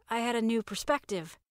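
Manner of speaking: ordinary delivery, nothing marked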